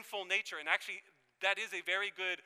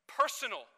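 "personal" set apart and punched louder; stress on PERSONAL; 4 semitones higher, 5 dB louder than usual. Shown as a demonstration. Preacher defining the Holy Spirit, heavy emphasis on "personal". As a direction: lean hard on "personal", raised and on its own. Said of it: "emphasis on the personal".

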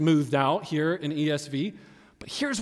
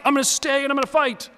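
high, fast, defiant outburst; defiant fighter; 9 semitones higher, much faster than usual. Acted. Someone facing opposition vows to stay, high and defiant. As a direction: rush it out high, ready to fight.

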